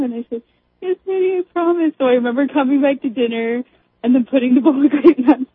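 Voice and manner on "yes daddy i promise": put-on high little-girl promise to Dad; retold meek child, pitched up; obedient child. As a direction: imitate a small kid giving in. Child promising dad in her story.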